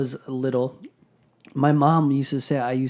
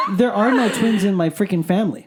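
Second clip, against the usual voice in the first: higher, emphatic denial through laughter; laughing disbelief; 5 semitones higher, 4 dB louder than usual. Real, not acted. Speaker rejects mom's twins story, laughing disbelief. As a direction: protest it, half laughing, pitch raised.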